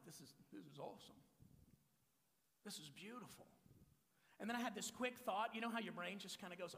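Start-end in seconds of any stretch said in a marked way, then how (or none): 0.1-3.5 soft, far quieter inner voice; hushed awe. Retold or imitated. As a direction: murmur it to yourself in wonder. Groom's awed thought at his wedding.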